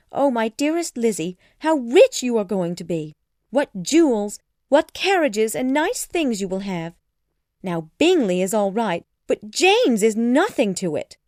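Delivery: high, loud, gushing raptures; overexcited mother. A narrator's reading. Mother gushes over daughter's rich match, overexcited voice. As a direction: swoop up on each delighted exclamation. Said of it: "She was too excited to think clearly".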